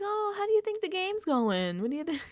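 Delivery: sing-song mock friendliness; mocking Mickey. Mocking Mickey's small talk, high sweet voice. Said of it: "She's like".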